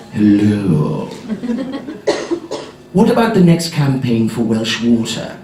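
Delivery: low croaky agent; a duck-like mumble from deep down; lightly done low agent voice; agent's voice. Retold or imitated. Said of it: "They talk like that".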